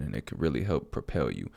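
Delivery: ordinary delivery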